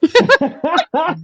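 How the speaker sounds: high, loud burst of laughter; real high-pitched laugh. Real, not acted. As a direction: crack up out loud. Laughing at being asked as mom.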